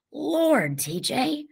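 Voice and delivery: high, hushed shock; whispered exclamation. A narrator's reading. Stacy sees T J's swollen stomach, whispered exclamation. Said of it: "Stacy exclaimed in a whisper".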